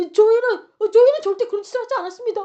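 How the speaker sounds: loud, high, rushed denial; acted panicked oath; swearing innocence. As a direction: protest frantically that you are innocent. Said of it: "막 결백을 맹세해요".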